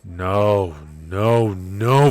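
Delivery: loud furious refusal, rising; angry no. Acted. Character refusing the deal, shouting no.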